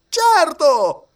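very high, loud outburst; impetuous exclamation. A narrator's reading. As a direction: blurt it out headlong. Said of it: "esclamò impetuosamente Passepartout". (esclamazione impetuosa, molto acuta e forte)

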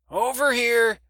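put-on a loud, friendly old woman waving him over; brash old woman calling. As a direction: holler across the dock to the young man. Doris calls out to Chad.

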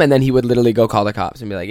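normal talking voice

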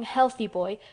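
plain reading voice

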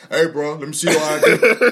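loud bossy security guard; self-important mall cop. Retold or imitated. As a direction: bark it like a pushy guard. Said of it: "they're not gonna talk like that security guard".

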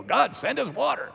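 pleading cry, pitched high; intense prayer. Acted. People praying for rain in a drought, intense plea. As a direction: pray it urgently, voice lifted high. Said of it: "prayer would be very intense".

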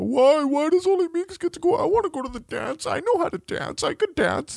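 put-on high pleading whine of a boy; mock whiny son begging to go to the dance; whiny kid son. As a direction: beg like a left-out little boy. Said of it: "Mateo's freaking out".

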